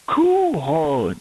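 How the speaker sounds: slow, stretched "couronne" in two parts; split into syllables. Shown as a demonstration. Teacher sounding out the word for correction, split into syllables. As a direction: draw out "cou", then "ronne". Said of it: "tu devras faire une syllabe".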